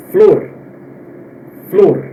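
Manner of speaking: flor with a closed o; closed Spanish flor. Shown as a demonstration. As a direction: say flor with a tight closed vowel. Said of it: "mais fechado que o português". (flor mais fechado)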